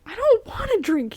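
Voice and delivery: high-pitched irritable protest; grumpy character's annoyed refusal; snarled. A narrator's reading. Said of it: "he snarled".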